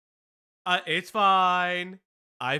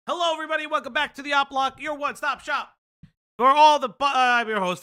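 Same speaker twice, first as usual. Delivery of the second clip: upbeat host opening; show host intro; 6 semitones higher, 6 dB louder than usual. Acted.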